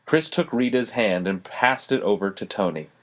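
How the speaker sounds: plain reading voice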